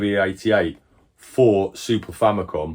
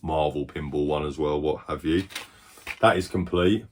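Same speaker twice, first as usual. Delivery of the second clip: usual speaking voice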